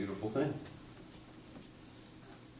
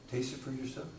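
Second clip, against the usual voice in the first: softer, offered gently; quiet punchline; 10 dB quieter, faster than usual. Acted. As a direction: hand it over softly.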